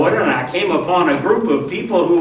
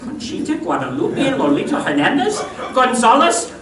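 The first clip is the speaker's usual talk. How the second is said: fast, rising higher through the long name; incredulous repeat; 10 semitones higher, faster than usual. Acted. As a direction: trip through the name in disbelief.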